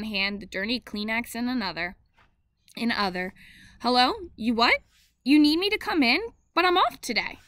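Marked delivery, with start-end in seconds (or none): 3.8-7.4 rising higher and louder in disbelief; startled protest on the phone; dismayed surprise. A narrator's reading. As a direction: sound caught off guard. Mother gets unwelcome work call.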